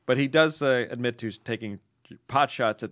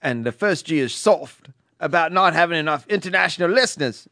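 reading the letter in a louder put-on Australian accent; 3 semitones higher, 4 dB louder than usual. Reading an Australian listener's letter, put-on Australian accent. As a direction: play the indignant Aussie listener, a bit loud. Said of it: "This is an Australian accent".

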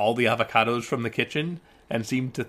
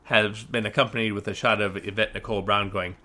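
ordinary talk, nothing marked

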